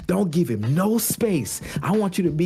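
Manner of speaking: Andre Iguodala, upset. Retold or imitated. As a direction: imitate a veteran scolding a teammate. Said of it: "he's so upset with Andrew Wiggins".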